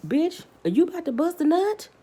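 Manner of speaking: high, teasing disbelief; mock incredulous. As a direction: sneer it out like a disbelieving friend. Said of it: "I'm like".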